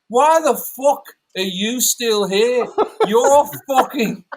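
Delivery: slow, high and loud Manc contempt. Retold or imitated. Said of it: "you do such a good fucking impersonation".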